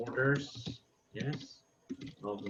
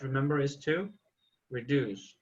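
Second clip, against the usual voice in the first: normal talking voice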